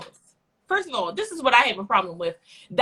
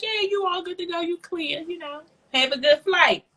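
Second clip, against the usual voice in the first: polite customer voice; 6 semitones higher than usual. Retold or imitated. Retelling own polite reply.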